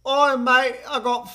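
loud, high mock-British accent. Player giving a clue the guesser misreads, loud British accent. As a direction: belt out a rough British impression. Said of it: "No, it was British".

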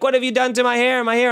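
high, fast shrill-free mock screech of a woman whose hair is stuck; hammed-up panic over her trapped hair, pitched high and quick; mock screaming woman. Imagined plane passenger with hair stuck in tray, mock yelling. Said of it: "she starts yelling and screaming".

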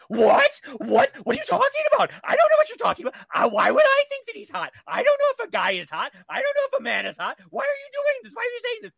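mock-panicked squeal of denial; panicked Kevin Spacey. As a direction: play a rattled man protesting too much. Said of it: "And Kevin Spacey went".

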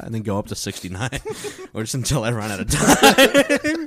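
normal talking voice

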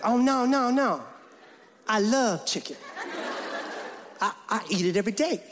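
exaggerated eighties rapper voice; [name]. Guest reassuring the mother about chicken, eighties rapper voice.

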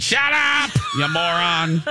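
angry dad shout. Retold or imitated. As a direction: bark it like a cranky father. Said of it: "like Howard Stern's dad".

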